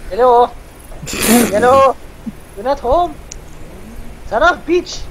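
high, strained shouting at a door; hammed-up squeaky caller losing patience; pleading squeaky Juan. Juan locked out by Mendez, hammed-up high pestering.

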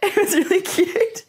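high, slow, laughing through tears; overcome, laughing and teary; real tearful laugh; laughing tearfully. Real, not acted. Laughs and cries at memory.

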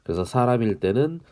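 ordinary delivery

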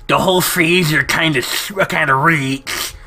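nerdy put-on voice; [name]. Salamander complains the freezer reeks, nerdy voice. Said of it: "nerdiest voice ever".

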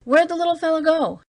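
high, exasperated demand. A narrator's reading. Young guard demands to know where the dwarf went. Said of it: "demanded profanely".

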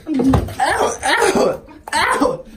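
real shouts of pain. Real, not acted. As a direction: yell out at each scratch. Cat scratching her.

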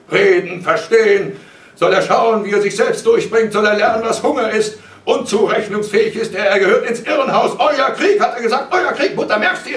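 high, very loud, relentless shouting; father's scornful tirade. A narrator's reading. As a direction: rage against talking it over. Father rejects plea, rants.

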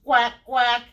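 loud, short duck quacks; punchy imitation duck call. Shown as a demonstration. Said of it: "make a sound like a duck".

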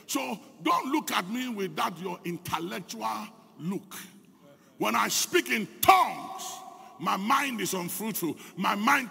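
loud, rising sermon delivery; forceful preaching. Acted. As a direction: preach it out to the congregation. Preacher builds to full force.

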